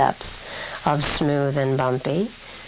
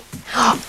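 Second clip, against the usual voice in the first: gasp; 7 semitones higher than usual. Real, not acted. Therapist reacts to child.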